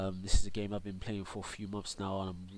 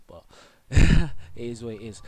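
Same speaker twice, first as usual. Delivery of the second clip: a real laugh breaking into the words; 14 dB louder than usual. Real, not acted. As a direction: shrug it off with a laugh. Laughs off his cold.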